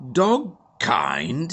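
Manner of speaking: the Major can't believe his ears; spluttering disbelief. A narrator's reading. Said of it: "he spluttered".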